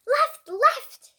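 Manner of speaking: high, loud game shout. A narrator's reading. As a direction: yell the direction at your brother. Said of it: "shouted A J".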